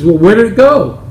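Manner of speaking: a hint of an impatient golfer; eager Bill.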